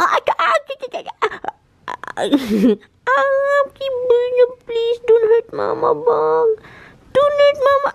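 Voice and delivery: high, loud pleading squeals. Real, not acted. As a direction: squeal and beg for mercy. Pet bites, she squeals.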